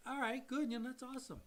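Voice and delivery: high, light offhand cheer; acted-out easy approval, pitched well up; breezy approval. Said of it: "I'm still way up here thinking".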